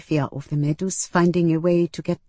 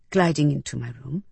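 usual speaking voice

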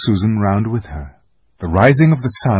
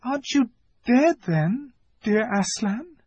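put-on very high, softer girl; timid hopeful question; Lucy wondering; 3 dB quieter than usual. A narrator's reading. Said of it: "said Lucy".

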